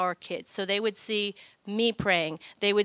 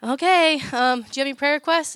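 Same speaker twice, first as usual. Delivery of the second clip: put-on higher, louder, fast child's voice; a nine-year-old bravely blurting to the cashier; nervous little girl; 5 semitones higher, 6 dB louder than usual. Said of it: "she's going".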